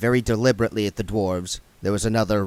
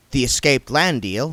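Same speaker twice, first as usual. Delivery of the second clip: ordinary delivery, nothing marked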